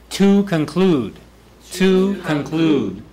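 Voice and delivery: slow, "To" held long and punched; stress on TO. Shown as a demonstration. Teacher models a closing phrase for the class, stressing "To". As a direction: stretch "To", then drop on "conclude".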